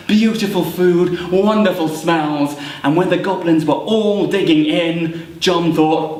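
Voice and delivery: ordinary delivery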